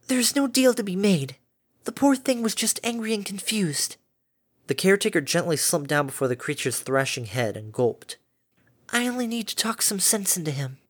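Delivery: calm a frightened beast kindly; gentle caretaker Fluttershy. A narrator's reading. Narrator voices Fluttershy.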